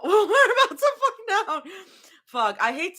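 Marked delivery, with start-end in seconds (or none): 0.0-1.6 laughing it out high. Real, not acted. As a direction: giggle through the words. Repeated through laughter.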